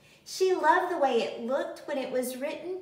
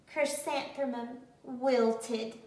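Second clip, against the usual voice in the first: ordinary delivery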